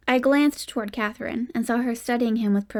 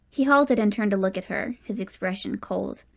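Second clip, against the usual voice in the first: plain reading voice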